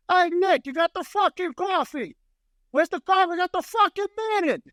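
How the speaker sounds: high, loud, hammed-up New York holler; loud New York boss. Boss calling Nick about coffee, shouted New York accent. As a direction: yell it in thick New York. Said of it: "you just gotta hear him".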